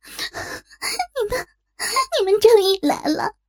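high, sobbing with emotion; starts faint and tearful, then swells; tearful relief. Acted. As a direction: cry with overwhelmed relief. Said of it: "让他激动地哭了起来".

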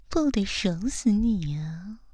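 ordinary delivery

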